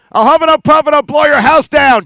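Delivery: big bad wolf line. Puppet Bear hams up the wolf's threat, loud. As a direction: blow the house down with a loud, playful boast.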